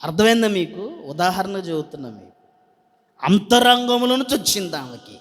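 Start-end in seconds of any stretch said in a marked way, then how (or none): none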